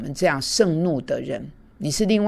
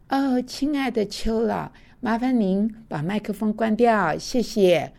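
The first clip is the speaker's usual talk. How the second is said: high, slow, syrupy sweet politeness; sugar-coat it like a gentle hostess; mock sweet teacher voice; overly sweet polite request; 7 semitones higher, slower than usual. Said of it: "我不会的，我不会这么说的".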